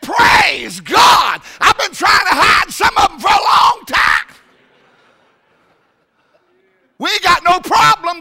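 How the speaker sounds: high, loud, delighted cry; joyful shout. Retold or imitated. Preacher shouting praise at the lost church roll. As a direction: shout it with glee.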